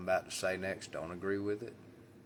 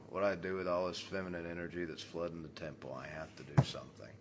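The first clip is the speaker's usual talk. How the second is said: ordinary delivery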